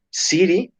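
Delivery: "city" said high and rising, t flapped like siri; English word demo. Shown as a demonstration. Teacher modelling "city" for Spanish-speaking students, rising. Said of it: "vean city de ciudad".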